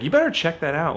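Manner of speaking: put-on mildly sassy kid's warning; a touch of kid sass. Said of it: "like, really sassy".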